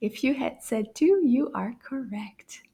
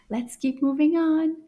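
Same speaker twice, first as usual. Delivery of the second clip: ordinary delivery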